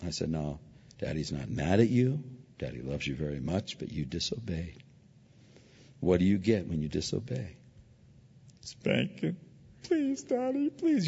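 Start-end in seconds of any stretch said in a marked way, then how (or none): 0.3-7.6 soft and low, loving but firm; calm a scared child before punishment; gentle fatherly voice. Retold or imitated. Father to his young son, soft and firm. 8.6-11.1 put-on high child voice begging; pleading child. Little son begging his daddy, high and pleading.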